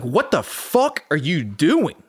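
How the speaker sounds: drawn-out, mock-outraged dad; incredulous dad. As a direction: act out a stunned dad demanding an explanation. Dad catches him tearing up freezer.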